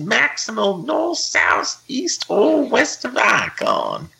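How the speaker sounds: very high, drawling cowboy voice; over-the-top Western accent, climbing high and stretching the end. Pretend. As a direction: build to a squealing, hammed-up Western twang. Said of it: "I'll do the voice one more time".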